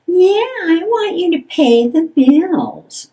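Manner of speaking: high, sneering mock of a nagging partner; mocking sarcasm. Partner mocking the other's complaint with high sarcasm. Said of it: "They may mock you by saying".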